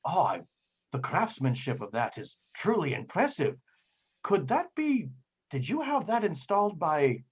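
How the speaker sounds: lightly acted fascination, rising on the questions; very interested. Sailor admiring a robot arm, very interested. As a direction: marvel at it with growing curiosity. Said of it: "becomes very interested and says".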